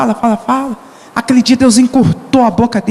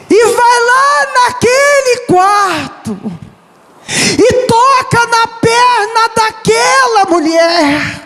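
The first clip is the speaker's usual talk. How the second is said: raised, slow, shouting preacher; fervent preaching; 9 semitones higher, much slower than usual. Acted. Preacher shouting to the church about his mother. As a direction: cry it out over the congregation.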